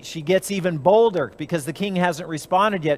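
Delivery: ordinary talk, nothing marked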